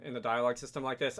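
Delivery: ordinary talk, nothing marked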